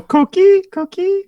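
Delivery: high rising frog chirp; coqui frog call. Retold or imitated. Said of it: "They literally go".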